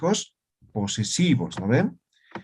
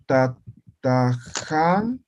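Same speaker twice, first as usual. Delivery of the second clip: very slow dictation; much slower than usual. Shown as a demonstration. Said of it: "Y yo digo".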